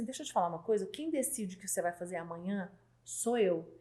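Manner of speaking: low, soft, firm reprimand; quiet authority. Retold or imitated. Boss telling an employee who decides, quiet authority. Chefe impõe autoridade com calma.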